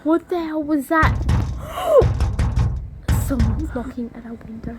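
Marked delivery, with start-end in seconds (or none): 1.2-3.2 high breathy gasp; sharp frightened gasp. Real, not acted. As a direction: gasp in shock.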